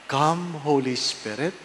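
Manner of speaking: ordinary talk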